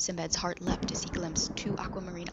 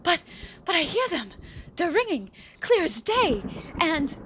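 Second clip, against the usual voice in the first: much higher, excited pleading; eager insistence; 11 semitones higher, 7 dB louder than usual. Acted. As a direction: insist like a giddy child.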